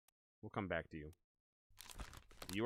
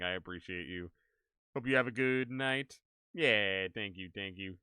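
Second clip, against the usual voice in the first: ordinary talk, nothing marked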